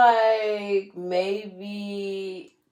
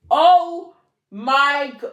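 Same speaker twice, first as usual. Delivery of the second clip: about to cry; 6 semitones higher, 9 dB louder, much slower than usual. Real, not acted. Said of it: "oh, I'm gonna cry".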